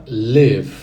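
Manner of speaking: "live" said loud and clear with a long I; "live" said loudly, stressed at the start; model pronunciation. Shown as a demonstration. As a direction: say "live" out loud for students to repeat. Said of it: "Repete aí, fala em voz alta".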